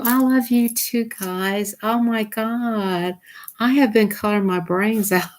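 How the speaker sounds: normal talking voice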